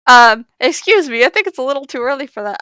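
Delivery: loud start, then rushed objection; flustered protest. Acted. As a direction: object in mock fluster, tumbling into haste. Flustered character objects to body slam.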